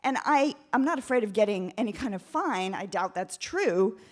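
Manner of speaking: ordinary talk, nothing marked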